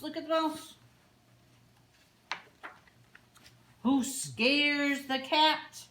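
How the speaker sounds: slow, loud, high dramatic build; building excitement. A narrator's reading. Librarian building the chain reaction for kids, slow and loud. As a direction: make each bite and scare bigger.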